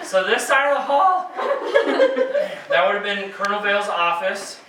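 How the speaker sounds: high breathy laugh, then winded words; can't stop laughing, out of breath; breathless laughing. Real, not acted. Laughing fit after climbing stairs.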